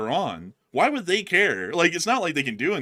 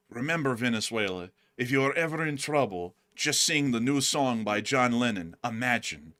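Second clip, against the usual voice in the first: softer, lower, hushed confidential tone; secret instruction; 4 semitones lower, 5 dB quieter than usual. Acted. Cuba telling Venezuela the secret signal, softer and lower. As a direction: pass on a secret code quietly.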